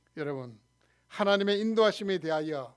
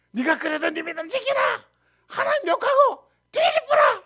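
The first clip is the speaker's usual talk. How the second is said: high, loud acted tirade; wife's angry outburst; 8 dB louder than usual. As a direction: shriek it like a wife railing at her husband. Job's wife railing at her husband. (악에 받친 아내의 고함)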